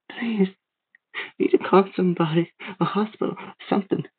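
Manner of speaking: second voice. A narrator's reading. Boy pleading with the man, high second voice. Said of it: "I said in my second voice".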